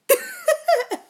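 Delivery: loud, high burst of laughter; high squealing laugh. Real, not acted. Laughing at her own joke about the voice. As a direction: shriek with laughter.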